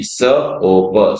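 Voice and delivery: स ओ प very slowly, one sound at a time. Shown as a demonstration. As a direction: break सोप into three slow sounds. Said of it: "S O A P". (स ओ प बहुत धीरे-धीरे)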